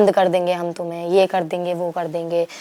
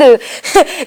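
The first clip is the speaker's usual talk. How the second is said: short laugh; 3 semitones higher than usual. Real, not acted. Nervous laugh mid-story.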